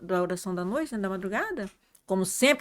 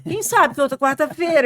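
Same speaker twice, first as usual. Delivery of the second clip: louder, higher retold comeback; animated retort; 5 semitones higher, 8 dB louder than usual. Speaker answers sister about another Wednesday, animated retort. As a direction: throw the doubt back, voice raised.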